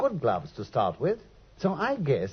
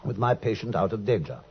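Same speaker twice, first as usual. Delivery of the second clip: measured, reflective storyteller reading; story narration; 3 semitones lower than usual. A narrator's reading. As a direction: narrate it like recalling an old case.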